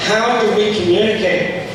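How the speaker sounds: normal talking voice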